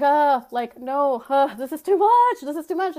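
high, loud, frazzled protest; overwhelmed outburst. Retold or imitated. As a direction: blurt it out, overwhelmed. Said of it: "And I was like".

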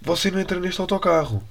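high, firm refusal; mock driver barring the door; stern bus driver. Bus driver telling the English man he can't board, high and firm. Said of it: "a mulher diz assim".

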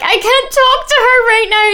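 high, loud mock hysterical cry. Kim fleeing her sister, mock hysterical high cry. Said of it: "ran in the house screaming".

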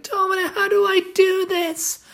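squeaky, pleading imitation of his partner; high helpless voice. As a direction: play her as high and lost. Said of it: "You were like".

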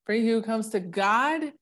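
For he who comes to God read big and theatrical; dramatic reading. Shown as a demonstration. Showing how she gets dramatic reading the verse aloud. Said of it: "I kinda get dramatic too".

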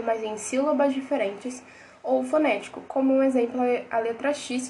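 usual speaking voice

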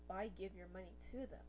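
usual speaking voice

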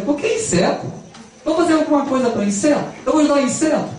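high, scoffing, fast disbelief; indignant disbelief. Acted. Sinner scoffing at the angel's idea, indignant and high.

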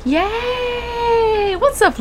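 very high drawn-out cheer; excited cheer. Real, not acted. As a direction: squeal with delight. Greeting guest.

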